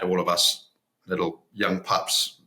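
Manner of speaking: ordinary talk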